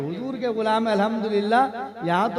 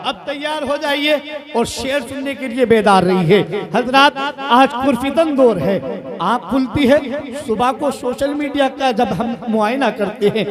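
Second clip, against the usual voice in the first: raised, emphatic preaching voice; fiery declamation; 5 semitones higher, 6 dB louder than usual. Real, not acted. Preacher readying the gathering for defiant couplets, fiery declamation. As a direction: proclaim it like defiant verse to a rally.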